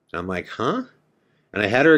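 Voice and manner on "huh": baffled huh. Retold or imitated. Baffled by her green hand story.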